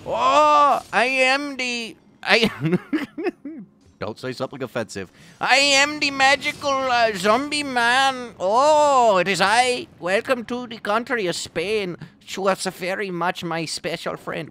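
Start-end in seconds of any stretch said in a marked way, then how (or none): 0.1-4.0 high, loud, hammed-up Spanish accent; mock Spanish accent at high pitch; funny Spanish accent. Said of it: "funny Spanish accents". 5.4-14.5 high-pitched, hammed-up Spanish accent; loud mock Spanish accent, easing off slightly; funny Spanish accent. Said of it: "funny Spanish accents".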